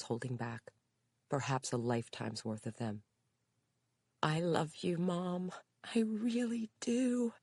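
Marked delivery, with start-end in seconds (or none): 4.2-7.3 high, slow, tender declaration; tender daughter. A narrator's reading. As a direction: tell her you love her.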